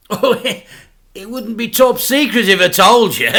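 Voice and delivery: loud, high, laughing; laughing dad. A narrator's reading. Dad dodges the question, laughing. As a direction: chuckle it away.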